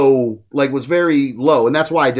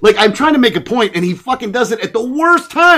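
raised, louder annoyed venting; fed up and pushing the point; real exasperation; 6 semitones higher, 4 dB louder than usual. Real, not acted. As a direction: vent it, higher and emphatic. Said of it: "it really is pissing me off".

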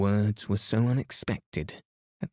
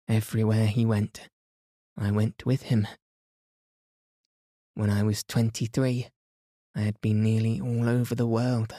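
put-on lightly raised amused young woman; Mary's voice; 2 semitones higher than usual. A narrator's reading. Narrator voices Mary.

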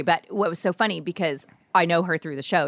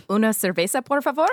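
mock Spanish; 4 semitones higher than usual. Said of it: "Mexican or Spanish".

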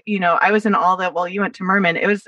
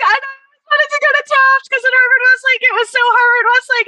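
excited; 5 dB louder than usual. Real, not acted. Excited retelling after big surprise.

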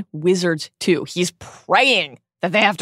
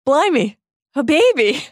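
Ron impression; 10 semitones higher, 5 dB louder than usual. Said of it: "Ron said".

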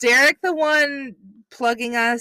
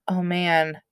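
ordinary talk

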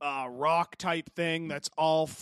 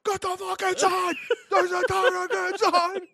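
much higher, louder frantic shouting; mock yell; 8 dB louder than usual. Retelling the neighbor's panicked warning, frantic shout. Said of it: "He's like".

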